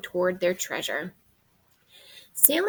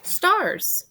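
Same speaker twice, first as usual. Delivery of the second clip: bright, much higher and louder answer; excited reveal; 10 semitones higher, 4 dB louder than usual. Acted. Revealing the answer to the guessing children, excited. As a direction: announce the answer with delight.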